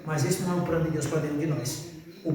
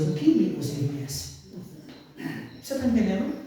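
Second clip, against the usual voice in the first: usual speaking voice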